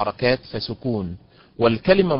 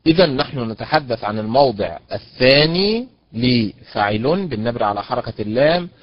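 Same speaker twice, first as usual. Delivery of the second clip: ordinary talk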